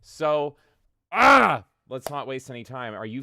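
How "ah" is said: excited. Real, not acted. Hypes up the show start.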